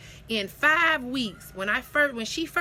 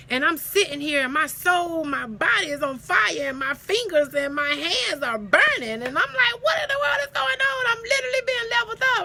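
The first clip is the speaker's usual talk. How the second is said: high, loud and rushing; overwhelmed with awe; ecstatic; 8 semitones higher, 6 dB louder than usual. Real, not acted. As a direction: spiritual elation. Ecstatic retelling of burning feeling.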